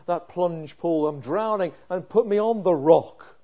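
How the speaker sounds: loud, urgent sermon voice; pleading with God on the psalmist's behalf; fervent preaching. Acted. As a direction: preach it out with full force.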